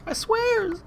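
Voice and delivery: jokey falsetto oath; squeaky mock swear. Promising viewers in a squeaky mock voice. Repeats promise, second much higher.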